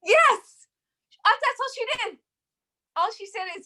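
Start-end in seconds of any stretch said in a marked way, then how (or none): 0.0-2.2 much higher, louder eager yes; excited. Real, not acted. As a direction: burst in with enthusiasm. Eager agreement.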